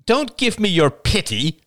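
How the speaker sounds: high, sharp rejection; bristling. A narrator's reading. Rachel rejects Ian's sympathy.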